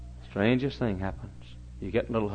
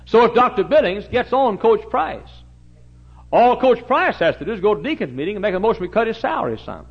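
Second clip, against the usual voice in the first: high, loud revival preaching; full-force preaching; 11 semitones higher, 9 dB louder than usual. Acted. Preacher explaining the deacon board to the church, loud and high.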